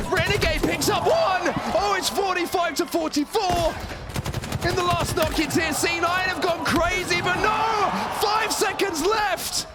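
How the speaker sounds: excited shouting. Real, not acted. Caster calls final seconds.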